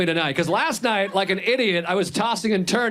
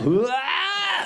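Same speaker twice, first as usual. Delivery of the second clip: dumbfounded "ah"; 12 semitones higher than usual. Shown as a demonstration. Comic shows what he came up with, high dumbfounded cry. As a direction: blurt a long, high, stupefied noise. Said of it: "That's what I came up with".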